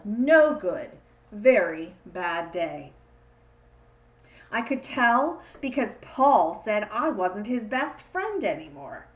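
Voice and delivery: ordinary delivery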